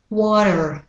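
American "water", T turned to D, R ending; American pronunciation. Shown as a demonstration. Teacher switches water to American accent. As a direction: say "water" American style with a tapped D and er. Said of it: "Now for the American pronunciation".

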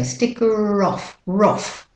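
slow "Sticker off", then "roff" starting on the R; British-Australian link, "off" becomes "roff". Shown as a demonstration. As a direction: carry the R of "Sticker" onto "off". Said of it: "So you have to link it".

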